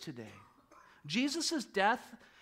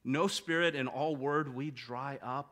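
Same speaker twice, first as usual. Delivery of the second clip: ordinary delivery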